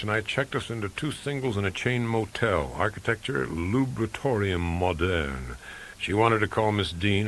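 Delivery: usual speaking voice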